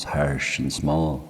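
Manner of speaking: plain reading voice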